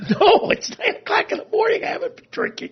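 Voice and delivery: telling friend Mike he has not been drinking, indignant; mock indignant protest. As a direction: protest that you are not drunk.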